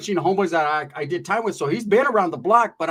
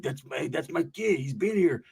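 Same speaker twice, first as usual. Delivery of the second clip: low, slowed homie voice; tío Big Homie; 3 semitones lower, 5 dB quieter than usual. Retold or imitated. Uncle claims the youngster as his kid, low imitated homie. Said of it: "tío Big Homie's like".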